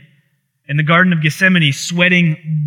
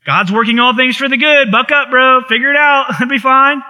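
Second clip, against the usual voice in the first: higher, louder, breezy and dismissive; mock brush-off; 7 semitones higher, 3 dB louder than usual. Mocking people who throw Romans at grief, breezy brush-off. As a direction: play the careless friend.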